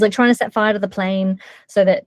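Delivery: usual speaking voice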